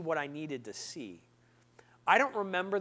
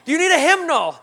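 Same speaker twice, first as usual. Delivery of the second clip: loud, high, eager question; hammed-up helpful greeter; overfriendly greeter; 9 semitones higher, 11 dB louder than usual. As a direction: keep pestering with offers. Greeter keeps offering.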